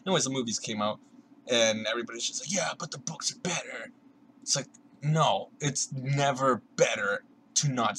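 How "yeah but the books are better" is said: hushed breathy whisper, smug fan; mock whisper. Mocking smug book fans in a whisper. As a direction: whisper it like a snooty secret.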